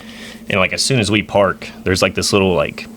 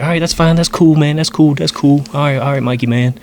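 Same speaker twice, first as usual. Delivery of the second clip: higher-pitched, rapid-fire reassurance; Uncle Jim; 7 semitones higher than usual. Retold or imitated. Uncle agreeing to his nephew's plan, repetitive high-pitched impression. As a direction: repeat yourself in a raised, easygoing uncle impression. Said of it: "that's how he talks".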